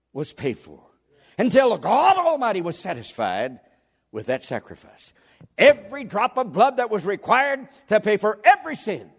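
high, loud pulpit proclamation; full-force preaching. Real, not acted. Preacher to congregation on Calvary, fervent proclamation. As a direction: ring out each phrase like a revival preacher.

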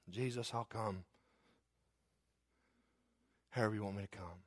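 hushed, submissive prayer; humble surrender. Acted. Sinner coming to Jesus however he wants, hushed surrender. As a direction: yield softly, with pauses.

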